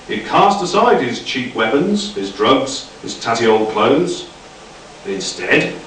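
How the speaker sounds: usual speaking voice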